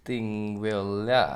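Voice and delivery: "Thingvellir" stretched out extremely slowly; careful Icelandic name. Shown as a demonstration. Reading the hard park name for co-host, "Thingvellir" dragged very slowly. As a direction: sound out "Thingvellir" syllable by syllable. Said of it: "อ่านออกเสียงยากอีกแล้วครับ".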